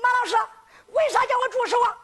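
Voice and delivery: high, quick youngster's voice; protesting pupil. Acted. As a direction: question the teacher, high-pitched and hurried. Pupil protests to teacher in story. (学生高声急问)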